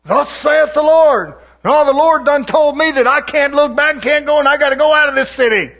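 preacher acting out the Old Testament prophets; acted old prophet. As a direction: play the pushy old prophet. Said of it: "He said".

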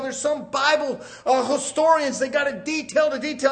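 fervent, driving sermon; preaching. Real, not acted. Preacher contrasting memorized Bible with spirit, fervent preaching. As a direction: preach it in insistent rolling phrases.

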